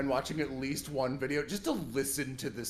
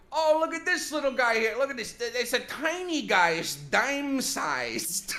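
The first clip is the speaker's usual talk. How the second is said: much higher, louder Chicago plant guy, slowing at the end; Chicago accent; 10 semitones higher, 4 dB louder than usual. Retold or imitated. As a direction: gush over a tiny cactus in a Chicago accent. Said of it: "and he's just like".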